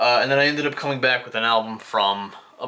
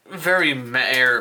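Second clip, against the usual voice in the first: normal talking voice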